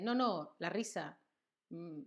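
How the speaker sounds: normal talking voice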